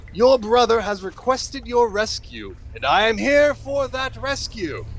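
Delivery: high, declamatory hero voice; pompous rescuer. Acted. Hero tells lieutenant of her rescue, pompous. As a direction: proclaim the rescue with grand ceremony.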